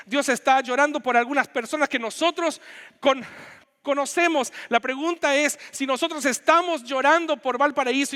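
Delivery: high, loud, impassioned pulpit delivery; Chilean pastor pleading with his church, preaching at full force. Acted. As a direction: preach it out to the congregation, building fervor. Pastor preaching with rising intensity.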